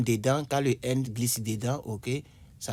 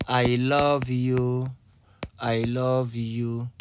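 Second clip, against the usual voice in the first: very slow "I love you" with "love" leaned on; slow dictation-style example; slow example reading; much slower than usual. Shown as a demonstration. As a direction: spell the example out slowly for learners. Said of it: "teacher reading an example sentence".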